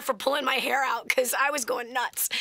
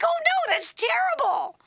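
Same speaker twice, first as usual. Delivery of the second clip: high, wailing mock dismay; sorry for him. Mother suddenly feeling sorry for her frustrated son, pitying wail. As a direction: cry out in pity, pitch shooting up. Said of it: "I felt so sorry for him".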